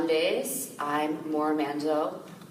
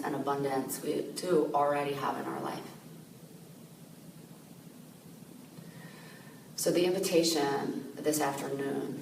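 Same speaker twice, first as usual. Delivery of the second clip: calm, softened voice with long pauses; guided meditation; 2 semitones lower, 6 dB quieter than usual. Real, not acted. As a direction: gently guide listeners inward, leaving space to breathe.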